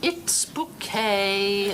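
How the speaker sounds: slow "bouquet", boo-KAY, stress on the second syllable; insists on bouquet. Acted. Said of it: "The accent on the second syllable".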